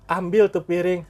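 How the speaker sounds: high, loud mock command; a firmer parent, lightly acted; old-time parent's order. Parent of the old days telling child to fetch a plate, mock command.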